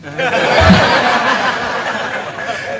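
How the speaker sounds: short laugh. Real, not acted. Laughs at own joke.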